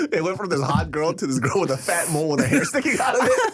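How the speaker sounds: normal talking voice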